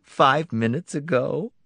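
high, amused teasing; teasing wife voice. Acted. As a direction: tease him lightly. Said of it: "She laughed".

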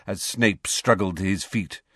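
ordinary talk, nothing marked

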